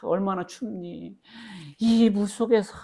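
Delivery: put-on mother grieving her child lost at sea. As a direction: cry out to a lost child in the cold water. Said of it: "그래서".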